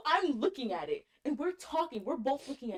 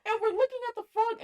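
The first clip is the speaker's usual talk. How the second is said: normal talking voice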